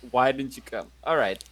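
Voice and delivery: usual speaking voice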